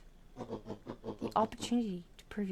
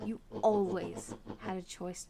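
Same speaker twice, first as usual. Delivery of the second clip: ordinary delivery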